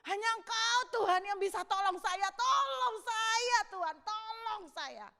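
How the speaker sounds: loud, high, drawn-out begging; shouted plea. Acted. David begging God for help, shouting. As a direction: cry out desperately for help. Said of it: "Mungkin dia teriak begitu".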